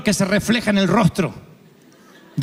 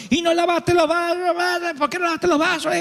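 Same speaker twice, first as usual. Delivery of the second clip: high, shouted mock scolding; mock angry wife; 9 semitones higher than usual. Friend retelling his wife's outburst at their son, hammed-up high shout. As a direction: screech the accusation like the furious wife.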